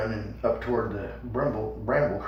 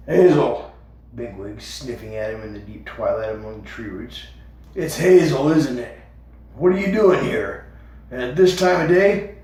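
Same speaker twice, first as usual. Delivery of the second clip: gruff Bigwig; 7 dB louder than usual. A narrator's reading. Bigwig the guard challenging Hazel, loud. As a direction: stop him at the entrance.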